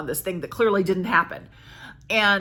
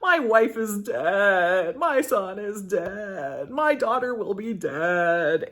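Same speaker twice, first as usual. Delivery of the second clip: mock remorse; 2 semitones higher, 3 dB louder, much slower than usual. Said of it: "Leontes is kind of like".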